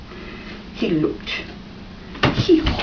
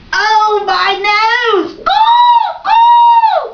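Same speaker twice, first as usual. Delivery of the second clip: high loud pained squawk; crow crying in pain; 12 semitones higher, 7 dB louder, slower than usual. Acted. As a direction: yelp like a crow that bumped its beak. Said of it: "cried Crow".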